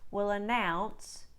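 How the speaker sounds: slow Australian ow sentences; broad Australian ow. Shown as a demonstration. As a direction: drill the wide ow slowly. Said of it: "Let's try some words and phrases for practice".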